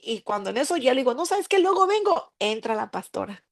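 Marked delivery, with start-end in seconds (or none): 1.1-2.3 much higher, hurried brush-off; nervous excuse. Retold or imitated. She tries to escape the church, high nervous excuse. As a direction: squeak out an excuse to flee.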